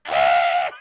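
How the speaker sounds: loud sudden scream of pain; loud pained yell. Retold or imitated. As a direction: scream as the door hits. Mom hit by door.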